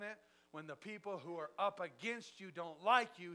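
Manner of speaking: high, loud, driving preacher's voice; preaching at full force. Acted. Preacher telling the church about God's favor, loud and fervent. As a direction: preach it to the back row with fire. Preaching his testimony to a congregation.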